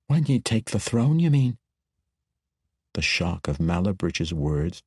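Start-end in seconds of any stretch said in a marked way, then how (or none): 0.1-1.6 lightly voiced dwarf girl, grieving; a hint of a rough dwarf lass missing her sister; raised, lightly sad dwarf sister. A narrator's reading. Mala questions.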